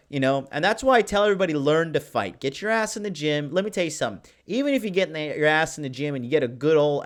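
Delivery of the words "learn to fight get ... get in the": ordinary talk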